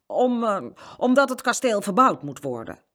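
improvised fib after a hesitation; stalling for an answer. A narrator's reading. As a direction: grope for it, then blurt it out. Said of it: "moest even nadenken".

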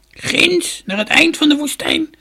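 put-on high, loud, creaky old woman's voice; shrill-pitched storybook crone, full voice. A narrator's reading. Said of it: "wees de vrouw".

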